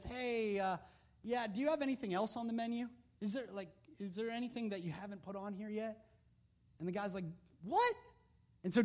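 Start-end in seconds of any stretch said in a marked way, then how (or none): 0.0-6.0 timid polite request. Retold or imitated. Daniel politely asks captors. 7.6-7.9 high, sharp disbelieving what; the guard can't believe the request; mock surprised guard; startled what. Captor reacts to Daniel.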